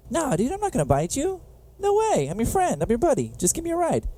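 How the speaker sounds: high pleading snake. Retold or imitated. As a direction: sweet-talk the otter into trusting you. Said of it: "the snake is like".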